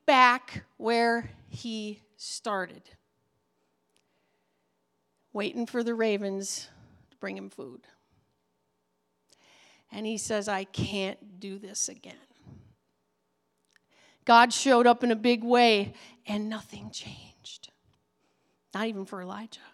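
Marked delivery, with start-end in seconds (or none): 0.1-12.2 weary despair. Acted. Despairing Elijah, soft low voice. 16.3-19.6 hushed whisper. Real, not acted. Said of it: "whispered punchline".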